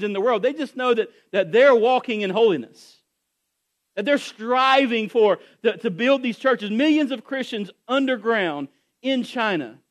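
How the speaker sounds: full-force preacher rising then dropping; preaching. Acted. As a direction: preach it with building fervour.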